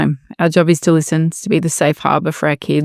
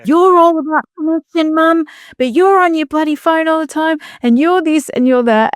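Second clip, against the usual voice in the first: put-on raised pitch, teasing kid voice; a daughter calling mum out, higher and teasing; mimicking daughter; 10 semitones higher than usual. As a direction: imitate your daughter scolding you. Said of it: "she has called me out many times going".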